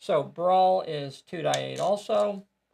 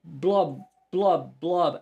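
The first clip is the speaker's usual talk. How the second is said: drowning gurgle; 4 dB louder, much slower than usual. Acted. Character drowning under waves.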